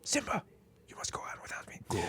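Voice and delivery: dying whisper; overacted death scene. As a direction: gasp out final words.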